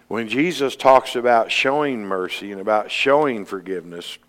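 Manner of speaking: usual speaking voice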